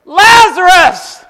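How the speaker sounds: high, loud and drawn out name call; long booming shout of the name; loud cry. Acted. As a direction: call him out of the grave. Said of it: "he cried out with a loud voice".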